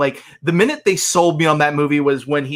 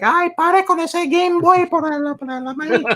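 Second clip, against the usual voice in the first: very high, hammed-up nagging in Spanish; complaining grandma impression. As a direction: nag like a fed-up grandma, pitched way up. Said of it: "just complained about how much Game Boy".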